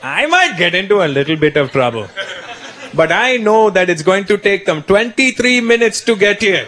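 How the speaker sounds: put-on accented father, measured threat; menacing dad. Dad answers his son's threat, deliberate and menacing. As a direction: play dad calmly calling the bluff, stretching the final warning. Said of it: "That was my dad's threat".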